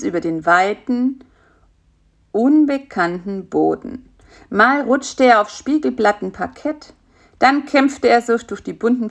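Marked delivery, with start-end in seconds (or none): none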